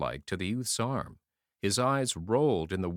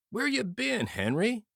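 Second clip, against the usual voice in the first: hint of a dazed soldier; 11 semitones higher than usual. Dying soldier greets friend.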